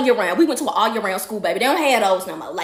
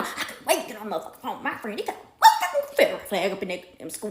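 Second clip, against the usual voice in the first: muttered griping; 4 semitones lower, 5 dB quieter than usual. Retold or imitated. Mother griping to herself driving.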